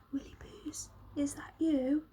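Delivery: weak, breathy whisper; faint whispered question; soft shaky whisper. A narrator's reading. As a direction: whisper as if barely alive. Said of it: "whispered a soft, shaky voice".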